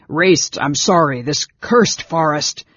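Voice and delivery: loud, agitated calling out; frightened swearing. A narrator's reading. Brother apologizes nearing cave, loud frightened swearing. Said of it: "He swore".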